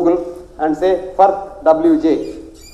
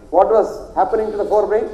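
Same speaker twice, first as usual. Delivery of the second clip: ordinary talk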